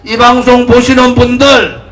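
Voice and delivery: impassioned political rally speech; roaring rallying speech. Acted. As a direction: rouse the crowd at full force.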